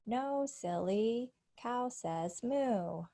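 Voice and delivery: slow, sing-song "No, silly. Cow says moo"; slow joke punchline. Shown as a demonstration. Teacher reading a knock-knock punchline to students, slow and playful. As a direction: drag out the punchline slowly. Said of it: "visual cueing for intonation and stress pattern".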